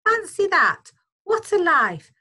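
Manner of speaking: high, loud jackdaw exclaiming in amazement; high-pitched jackdaw character voice; jackdaw talking to himself. A narrator's reading. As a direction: marvel out loud as a cheeky bird. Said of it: "said Jackdaw to himself".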